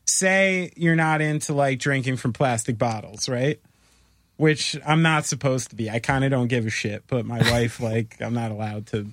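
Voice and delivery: ordinary talk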